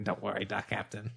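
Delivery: ordinary delivery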